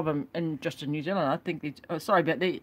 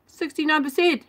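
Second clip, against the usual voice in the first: loud, high outraged exclamation; exasperated; 10 semitones higher, 7 dB louder than usual. Real, not acted. Vlogger outraged at student heating figures, loud disbelief. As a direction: blurt it out in disbelief.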